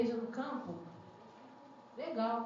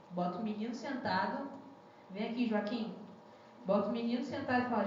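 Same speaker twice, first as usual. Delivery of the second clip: normal talking voice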